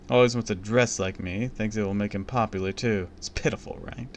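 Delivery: plain reading voice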